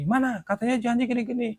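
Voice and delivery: tiruan penagih utang yang kesal, nada tinggi; penagih memaki. Retold or imitated. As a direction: menagih janji dengan nada tinggi, cepat. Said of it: "saya dimaki-maki". (menirukan penagih marah-marah)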